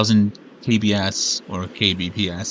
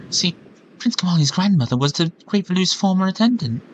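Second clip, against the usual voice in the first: a hint of a higher, earnest girl voice; lightly voiced bird girl; 6 semitones higher, 3 dB louder than usual. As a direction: read the bird girl's lines with light admiration. Reads Medli's lines in voice.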